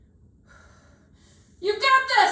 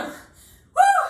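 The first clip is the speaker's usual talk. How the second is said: excited whoop; 10 semitones higher, 5 dB louder than usual. Real, not acted. Coach cheering during workout.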